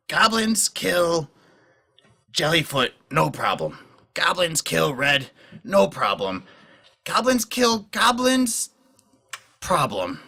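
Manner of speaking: slow goblin voice in broken speech; goblin, simple and pointed. Acted. Game master as goblin weighing who may be killed, slow pidgin. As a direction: lay it out slowly, pitch jumping up then dropping.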